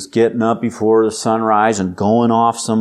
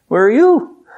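pointed question; 9 semitones higher, 4 dB louder than usual. Acted.

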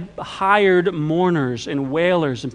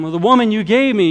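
louder, higher defensive protest; blame-shifting; 6 semitones higher, 7 dB louder than usual. Acted. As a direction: push the blame onto someone else. Acting out Adam shifting blame.

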